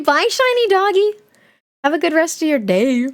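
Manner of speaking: cooing goodbye. Real, not acted. Streamer cooing goodbye to a dog emote. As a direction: coo it like to a pet.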